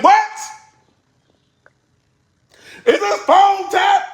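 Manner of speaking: high, loud alarmed question, slow; mock panic that the phone is bugged; shocked exclamation. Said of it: "I'm like".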